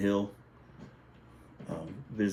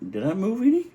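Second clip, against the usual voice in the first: high-pitched question; 11 semitones higher, 3 dB louder than usual. Retold or imitated. Acts himself checking his picture's place.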